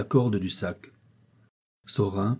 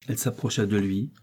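usual speaking voice

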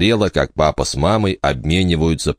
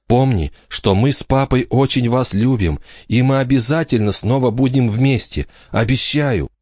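tearful mother; 8 semitones higher than usual. A narrator's reading. Mother crying saying goodbye.

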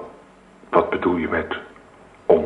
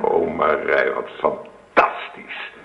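breathless, slow, hushed wonder; hushed delight at the baby news; awed and tender; 4 semitones lower, much slower than usual. Acted. Husband learns wife is pregnant.

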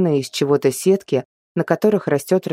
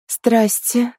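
higher, lightly voiced young girl; timid girl voice; 7 semitones higher than usual. A narrator's reading. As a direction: babble it shyly, like a young girl. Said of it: "пролепетала девушка".

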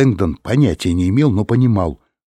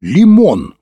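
high, loud exclamation; ringing cry of discovery; exclaiming; 10 semitones higher, 9 dB louder than usual. A narrator's reading. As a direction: call it out as a sudden realisation. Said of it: "воскликнул он".

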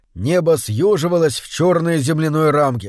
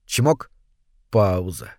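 чмок up, пауза dropped low; rhythmic pump; 7 semitones lower, slower than usual. A narrator's reading. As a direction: mechanical beat: чмок rising, пауза falling deep. Помпа. (ритмично: «чмок» вверх, «пауза» низко вниз)

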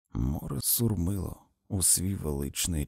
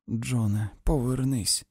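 ordinary delivery, nothing marked

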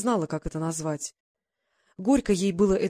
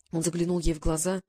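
ordinary delivery, nothing marked

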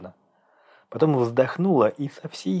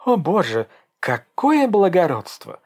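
exclaiming, exasperated; 9 semitones higher, 5 dB louder than usual. A narrator's reading. Dasha mocks Egor's claim to the child, exasperated. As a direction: exclaim it with an eye-roll. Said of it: "воскликнула девушка, закатив глаза".

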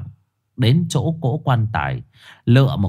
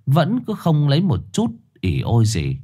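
usual speaking voice

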